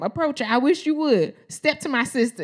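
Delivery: high, punchy mock threat; challenging little sister. Little sister eyeing the men catcalling, high mock threat. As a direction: act a small girl daring them to try. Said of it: "She was, like, looking at me".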